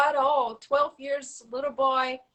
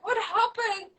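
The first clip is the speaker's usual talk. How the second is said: surprised question; 9 semitones higher than usual. Retold or imitated. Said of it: "I'm like".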